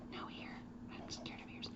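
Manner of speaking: whispered, playfully fearful; mock scared whisper. Teacher pretending to be a student afraid of ears, whispered. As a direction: hush it like a silly confession.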